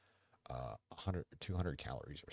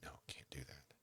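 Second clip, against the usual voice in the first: hushed restraint; 12 dB quieter than usual. Retold or imitated. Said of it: "they're like".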